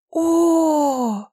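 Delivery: high, loud, long ecstatic "oh"; delighted "Oh!". A narrator's reading. Goddess hearing of gold for a temple, long delighted "oh". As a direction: draw out a rapturous exclamation. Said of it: "восторженное восклицание".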